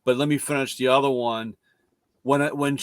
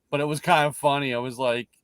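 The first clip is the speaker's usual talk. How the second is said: ordinary talk, nothing marked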